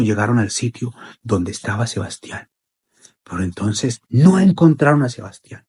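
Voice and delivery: plain reading voice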